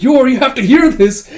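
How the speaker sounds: high, loud, urgent call; bursting-in shout. Retold or imitated. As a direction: burst in yelling with news. Yuri slams the door and intrudes.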